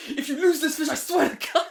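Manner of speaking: high, loud mock threat; hammed-up screaming dad, half laughing; imitated screaming. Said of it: "screaming at me".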